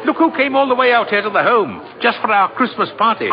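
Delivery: excited call. Acted. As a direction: call out happily with news. Calling out to Alfred happily.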